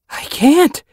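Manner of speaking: high, loud choked reply. Acted. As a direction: squeeze the words out, choking. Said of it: "Yellow Fang choked".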